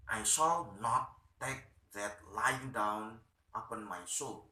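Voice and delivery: usual speaking voice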